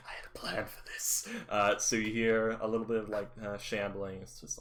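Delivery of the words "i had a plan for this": hushed offstage whisper to himself; whispered muttering. Acted. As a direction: mutter it under your breath.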